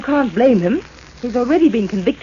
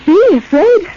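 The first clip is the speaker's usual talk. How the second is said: scoffing; 8 semitones higher, 7 dB louder, slower than usual. Acted. Teased about being afraid.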